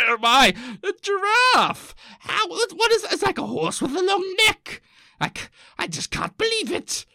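very high-pitched, hammed-up Victorian amazement; astonished old-timey Victorian. Victorian seeing a giraffe for the first time, high astonished squeal. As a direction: squeal in mock disbelief like an old-timey zoo visitor. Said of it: "the old-timey people going like".

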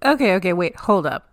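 normal talking voice

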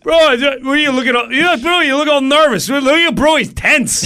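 loud, high teasing pest; hammed-up heckling buddy; needling bro. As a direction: pester him at high pitch. Chatterbox needles him about nerves.

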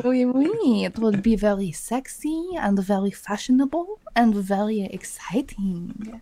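hammed-up French accent, trailing into a drawn-out whisper. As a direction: play a sultry mock Parisian. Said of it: "[name]'s the best at imitating accents".

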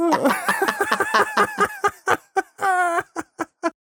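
a very high, breathless laughing fit. Real, not acted. Host cracking up at the discovery, high laughing. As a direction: dissolve into high giggles.

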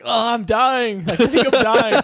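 mock freak-out. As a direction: imitate someone panicking that it's the end. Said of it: "he's, like, freaking out".

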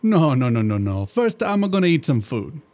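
put-on thick comic Italian genie accent; a jolly Italian genie, hammed-up accent. Genie chatting to his master in a hammed-up Italian accent.

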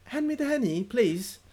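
high-pitched mock British politeness; UK accent. Guest asking British parents for Henny, mock UK accent. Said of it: "start talking in a U K accent".

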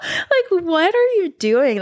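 high, laughing what-are-you-doing; laughing disbelief. Real, not acted. Reacting to the demon story, laughing in high disbelief. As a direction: crack up in disbelief.

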